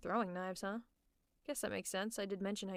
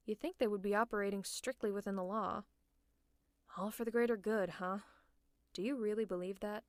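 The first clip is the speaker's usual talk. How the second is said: ordinary delivery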